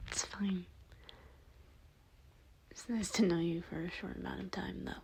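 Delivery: hushed, soft whispered goodbye; whispered farewell. Acted. Bob to the listener before his sacrifice, whispered. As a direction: whisper so no one hears you.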